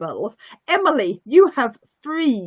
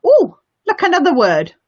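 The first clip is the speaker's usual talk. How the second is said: mock surprise; 5 semitones higher, 6 dB louder, faster than usual. Teacher feigning delight for kids.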